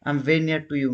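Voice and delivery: usual speaking voice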